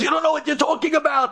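high-pitched acted protest; angry. Taxi driver dismissing the Kolel guy, angry. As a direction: retort angrily, pitched well up. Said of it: "The taxi driver got angry".

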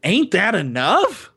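lightly voiced Rogue, slow and drawn out; a hint of Rogue's sassy line; quoting Rogue. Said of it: "the perfect Rogue response".

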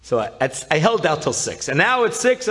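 ordinary talk, nothing marked

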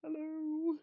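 high, loud, put-on squeak; mock timid intruder. Intruder caught by dad in hallway, squeaky mock innocence. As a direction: play a hapless lost girl, high-pitched.

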